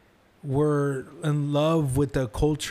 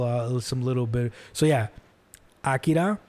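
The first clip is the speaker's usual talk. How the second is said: ordinary talk, nothing marked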